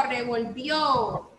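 revolvió said slowly, weight on the last "ó"; slow teacher's revolvió, stressed on the final syllable. Shown as a demonstration. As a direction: draw out revolvió toward its accented ending. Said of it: "Lleva acento, chicos, en la O".

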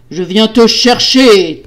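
loud, slow, menacing boom; thundering shout. A narrator's reading. Husband coming for his wife, thundering threat. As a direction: thunder it, stretching the last word. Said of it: "tonna-t-il".

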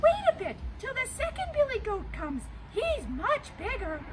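little goat sending the troll to wait for his brother; small goat. Acted. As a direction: coax the troll in a tiny high voice. Tiny goat still pleading.

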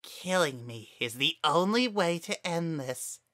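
high creepy taunting voice; smirking flower. Acted. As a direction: goad him into the kill. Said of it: "the smirk in that voice".

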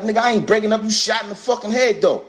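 incredulous, replaying his own reaction; disbelief. Answering Pac in disbelief.

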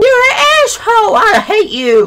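loud, high screeched tantrum; raging. Pretend. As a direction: scream it at the game in mock fury. Rages at the game.

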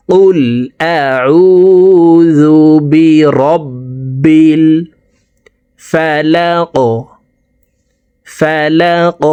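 slow, drawn-out chanted recitation; Quranic recitation. Shown as a demonstration. Teacher reciting to students, slow melodic tajweed chant. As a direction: recite it melodically, stretching each vowel.

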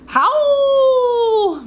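high loud coyote howl, laughing through it; howling with the kids. Shown as a demonstration. Teacher howling together with the children, high and loud. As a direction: throw your head back and howl along. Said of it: "Can you howl with me?".

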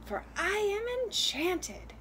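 high, grand-promising wren voice; little talking bird. Acted. Enchanted wren grants its rescuer a wish, high bird voice. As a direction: pitch it up as a magical little bird. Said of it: "the bird said".

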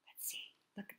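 hushed look-at-this whisper. Acted. Teacher pointing children to the picture in a whisper. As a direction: lean in and whisper it.

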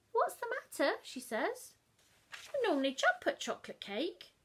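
brisk morning question; Mum asking. Acted.

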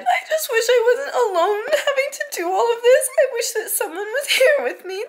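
crying while confessing loneliness; real sobbing, choked laughs. Real, not acted. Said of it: "a lot of crying in this video".

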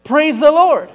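loud, high exclamation of praise; shouting praise. Acted. Lady finding groceries on the porch, shouting praise. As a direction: belt out your thanks. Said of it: "and she shouts".

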